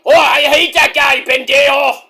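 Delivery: loud, high mock Mexican accent; Eddie Guerrero impression. Eddie agreeing with the host, loud high Mexican-accented rant. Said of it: "Right, Eddie?".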